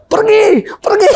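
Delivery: very high-pitched mock yell through laughter; tiruan mengusir. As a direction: imitate panicked shooing, about to crack up. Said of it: "kata kita kan". (tiruan teriak mengusir sambil menahan tawa)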